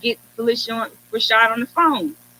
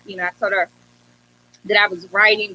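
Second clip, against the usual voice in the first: ordinary talk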